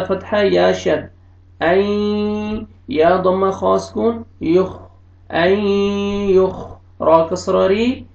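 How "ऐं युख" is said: slow drawn-out Quranic recitation; careful Arabic recitation, slowed right down. Shown as a demonstration. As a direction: stretch each sound like tajweed. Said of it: "teacher models recitation".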